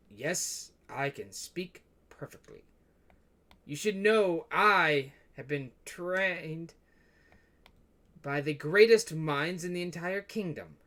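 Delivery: slow, deliberate kingly reply; haughty king. Acted. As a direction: draw the words out with royal self-importance. King proudly answers a taunt.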